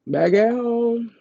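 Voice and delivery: high and slow, savouring the win; triumphant. Real, not acted. Telling viewers she got sent home, high and slow. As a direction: stretch it out like a victory lap.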